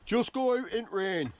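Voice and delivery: light mock northern accent, slow and high; a hardy northern detectorist's brush-off, lightly imitated. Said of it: "why they're all northern".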